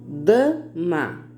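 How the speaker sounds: "Dama" split into da, ma; two separate beats on "Dama"; syllable by syllable. Shown as a demonstration. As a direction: put a small gap between the syllables of "Dama". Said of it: "duas sílabas".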